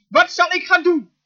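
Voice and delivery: high-pitched, helpless appeal; crying out. Acted. Preacher acts Moses at the crisis, crying out. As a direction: call out as if stranded. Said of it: "hier riep hij het uit".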